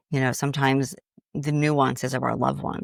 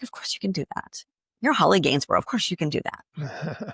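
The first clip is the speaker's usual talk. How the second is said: reassuring body voice; 3 semitones higher than usual. Retold or imitated. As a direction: calmly reassure yourself. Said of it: "if I go into my body".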